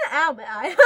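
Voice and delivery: loud laughing ow with a cry; laughing through pain. Real, not acted. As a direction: yelp and laugh at once. Laughing hurt outburst.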